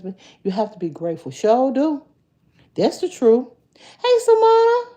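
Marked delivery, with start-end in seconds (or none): 3.8-4.8 high squealed greeting. Real, not acted. Vlogger greeting a viewer by name, high and loud.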